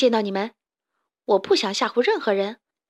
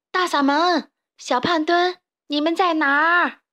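raised voice hailing someone far away; calling out loudly; 6 semitones higher, 4 dB louder than usual. Acted. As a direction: call for lost friends in the woods. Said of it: "卡梅利多大声喊道".

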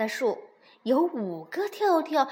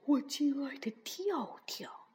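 tiny whisper; 2 semitones lower, 8 dB quieter than usual. A narrator's reading. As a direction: whisper like a mosquito. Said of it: "用蚊子大小的声音".

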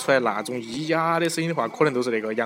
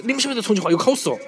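excited; 9 semitones higher, 3 dB louder, faster than usual. Real, not acted. Playful quiz to listeners.

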